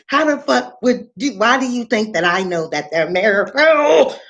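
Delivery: ordinary delivery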